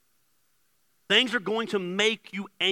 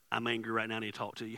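low, soft admission; quiet confession; 6 semitones lower, 7 dB quieter, faster than usual. Retold or imitated. Preacher retelling a phone call confessing anger, quiet. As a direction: confess it softly, as on a private call. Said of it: "called Chrissy".

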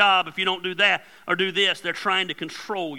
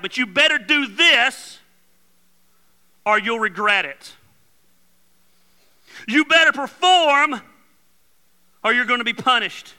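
threatening controller; 4 semitones higher, 4 dB louder than usual. Acted.

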